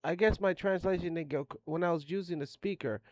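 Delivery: plain reading voice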